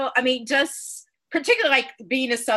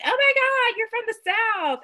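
mock surprise; 12 semitones higher than usual. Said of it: "they're like".

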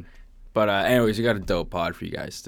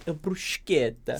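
"A bruschetta" said slowly with a big Italian lilt, "bruschetta" as broosketta; hammed-up Italian, draw out "A bruschetta"; Italian accent; 8 semitones higher, slower than usual. Shown as a demonstration. Nailing the Italian accent for his cohost, drawn-out Italian "A bruschetta". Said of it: "See, there it is".

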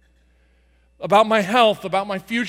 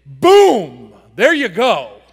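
sudden loud blast; explosive boom; 2 semitones higher, 11 dB louder, much slower than usual. Shown as a demonstration. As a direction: hurl the word out with force. Acts out violent casting of anxiety.

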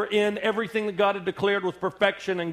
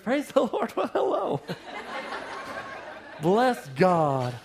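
fake church smile. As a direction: switch on the sweet churchy politeness. Fake-cheerful church greetings.

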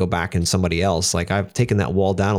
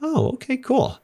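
chirpy; slower than usual. Retold or imitated. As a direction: squeak it out in a lightly mocking falsetto. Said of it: "She'll be like".

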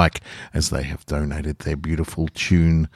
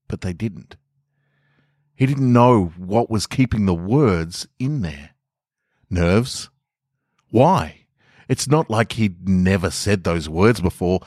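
story narration. A narrator's reading. Reads own short stories aloud.

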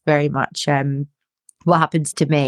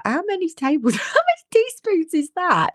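much higher, baffled, with a laugh; mock confusion; 13 semitones higher than usual. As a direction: play clueless. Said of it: "You're like".

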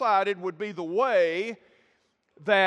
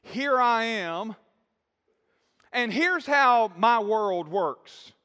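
slow, louder, smug lecture to God; mock arrogant debate; 3 semitones higher, 5 dB louder, slower than usual. Preacher mocking believers who argue with God, slow and smug. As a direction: spell out your own world like you know better. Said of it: "we're not to debate".

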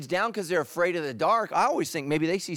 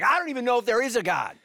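a mock doubter's blurt, higher, louder and quick with a laugh; tossed off fast as a half-joking doubter; doubting believer; 7 semitones higher, 4 dB louder, faster than usual. Pastor voicing a doubting believer's quick laughing blurt. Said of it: "you're like".

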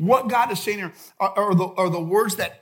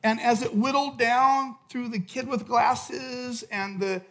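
soft, low, intimate sermon voice; hushed preaching; 3 semitones lower, 5 dB quieter than usual. Acted. Preacher quietly urging each disciple, hushed and intimate. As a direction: lean in and speak softly to each listener.

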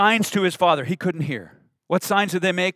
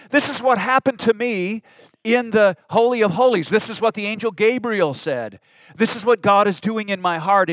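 earnest explaining; 5 semitones higher, 5 dB louder than usual. Acted. Voicing Zechariah explaining to his wife.

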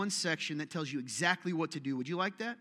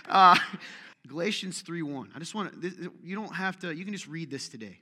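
ordinary delivery

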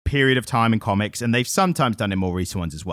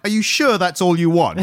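mock devil; 8 semitones higher, 4 dB louder than usual. Devil quoted mockingly.

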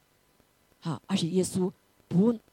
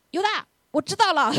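high, loud mock call-out to Judas; hammed-up indignant confronting; mock accusation; 11 semitones higher, 6 dB louder than usual. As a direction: act out a pouncing accusation. Imagined Jesus accusing Judas.

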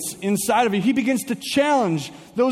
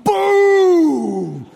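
a loud booming crash noise, high and punched; 8 semitones higher, 6 dB louder than usual. Retold or imitated. Pastor mimics the car hitting, loud crash boom. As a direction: blurt out the crash like an explosion.

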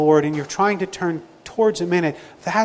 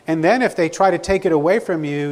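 normal talking voice

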